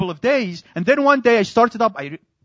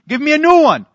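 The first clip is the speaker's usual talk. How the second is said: loud, higher demand to the salesman; 8 semitones higher, 8 dB louder than usual. Retold or imitated. Customer demanding a new monitor from the salesman, loud and insistent. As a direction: insist on a replacement.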